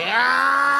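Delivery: high sudden yell of a lunging attacker; attacker's yell. Retold or imitated. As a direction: scream as you jump. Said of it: "He was like".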